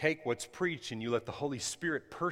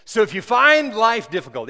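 the line read again much louder and higher; louder reread; 12 semitones higher, 18 dB louder than usual. Shown as a demonstration. Preacher rereads his text to the church, loud and high. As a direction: punch the reread with full preaching force. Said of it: "I gotta read that again".

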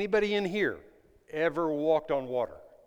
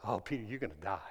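hushed, taunting doubts; mocking inner voices; 5 semitones lower, 8 dB louder than usual. Preacher voicing the doubts that mock Peter, hushed and sneering. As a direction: sneer low like the voice of fear. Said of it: "those voices".

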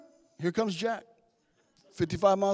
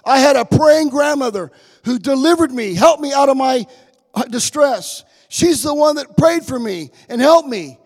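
loud, high, slow emphatic preaching; full-force preaching; 5 semitones higher, 8 dB louder, slower than usual. Real, not acted. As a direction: proclaim it to the congregation, stretching the key words.

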